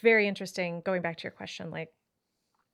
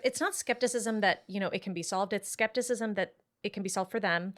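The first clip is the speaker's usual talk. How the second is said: usual speaking voice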